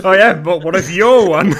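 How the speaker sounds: loud laughing teasing question. Real, not acted. GM laughs at the claim.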